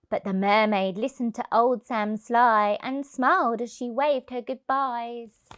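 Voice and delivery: ordinary delivery, nothing marked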